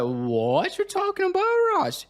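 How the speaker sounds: very high-pitched incredulous outburst; hammed-up high-pitched protest; feigned disbelief. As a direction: squeak it out as if astonished. Boy feigns disbelief at orc's denial.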